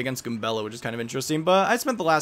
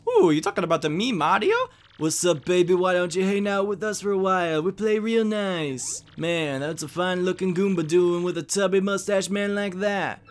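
higher, drawling catcaller; flirty street thug; 6 semitones higher, slower than usual. Acted. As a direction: leer it out as a sleazy goon.

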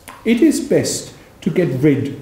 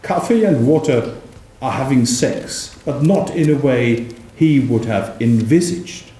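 plain reading voice

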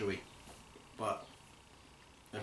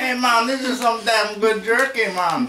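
much higher, louder, hammed-up Jamaican accent; 14 semitones higher, 14 dB louder than usual. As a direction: belt it out in a bad Jamaican accent, laughing at the end. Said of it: "I was gonna do my Jamaican accent".

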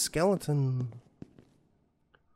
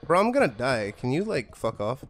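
panicking gamer; 3 dB quieter than usual. Real, not acted. Chased by guard in game.

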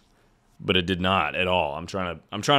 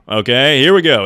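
loud hyped start; pumped up; 4 semitones higher, 8 dB louder than usual. Real, not acted. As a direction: psych yourself up loudly. Hyping himself up.

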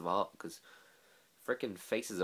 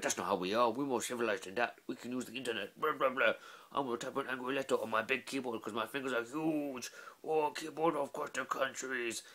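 slightly higher, huffy troll ranting online; offended internet troll; 5 semitones higher than usual. Retold or imitated. Troll answering the film on YouTube, huffy mock rant. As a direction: play a grumpy troll typing an angry comment. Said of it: "a troll who's seen this film and just been like".

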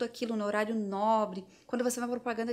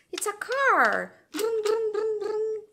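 much higher, louder, slow and sing-song; playing cars with a toddler; playful car sounds; 11 semitones higher, 5 dB louder, much slower than usual. Shown as a demonstration. Mother playing with a toy car, excited sing-song. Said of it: "Você pode imitar sons divertidos".

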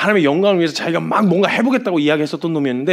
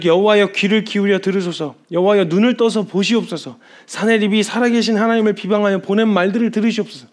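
higher, louder acted plea to God; Hezekiah's pleading prayer; 5 semitones higher, 3 dB louder than usual. Preacher acts out Hezekiah praying at the temple, pleading. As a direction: cry out in earnest prayer, raised voice. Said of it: "히스기야가 기도를 하기 시작합니다".

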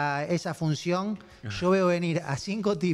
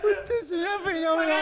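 high, squeezed laughing voice; cracking up; 11 semitones higher than usual. Retold or imitated. Co-host repeating the apology, helpless laughter. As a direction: echo the quote in near-tearful laughter.

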